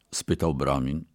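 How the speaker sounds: ordinary delivery, nothing marked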